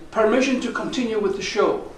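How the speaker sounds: ordinary delivery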